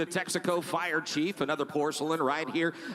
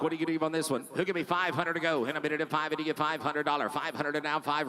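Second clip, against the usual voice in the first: fast rhythmic auction chant; auctioneer; faster than usual. Real, not acted. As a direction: rattle off the bids like an auctioneer. Auction bid calling.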